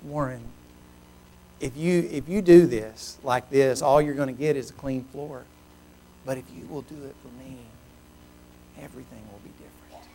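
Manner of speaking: soft, low voice, fading to a hush; hushed voice of God, retold; small, still voice. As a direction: speak gently with long pauses. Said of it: "I hear this small, still voice".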